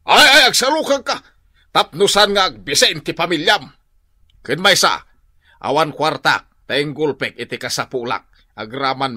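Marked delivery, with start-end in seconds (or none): 0.0-3.6 louder, higher scolding; scolding shout. Acted. Old father scolding his son to work for his family. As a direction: scold him hard.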